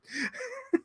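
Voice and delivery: high squeaky giggle; a short squeaky laugh. Real, not acted. Laughing at Luke's flip, a high giggle.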